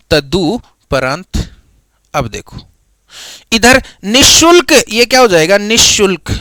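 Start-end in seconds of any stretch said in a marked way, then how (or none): 0.1-1.4 तदुपरांत slow with a rising end; slow dictation. Shown as a demonstration. Teacher to students, dictating the word slowly. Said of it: "शब्द क्या है".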